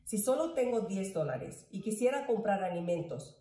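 normal talking voice

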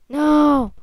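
loud, high cry of dismay; frustrated shout. Real, not acted. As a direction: yell at the loss. Losing in the game.

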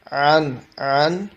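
আন drawn out slowly from mid-throat; slow demo of ain. Shown as a demonstration. Teacher modelling আন slowly for a student. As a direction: stretch আন, sounding the ain from the throat. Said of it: "আইন হলকের মাঝখান থেকে উচ্চারিত হবে".

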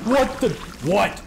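sudden high startled yelp; jumpy what-the. Real, not acted. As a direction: caught off guard, blurt it out. Startled by something in game.

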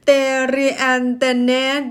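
loud sounding out "เต รียนเตเนด" syllable by syllable; clueless misreading. Acted. Tongue-tied learner climbing through the word, clueless loud "เต รียนเตเนด". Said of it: "ข้อยก็ขึ้นไปเรื่อยเนาะ".

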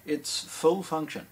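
usual speaking voice

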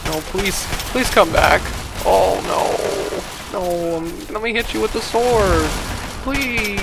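game enemy begging the player to come back, pleading; desperate pleading. Retold or imitated. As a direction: plead like a beaten soldier, hammed-up. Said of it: "He's so desperate".

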